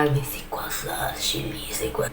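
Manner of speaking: low, hushed muttering in puzzlement; puzzled aside, half whispered. Acted. People wondering what Chile even is, puzzled hush.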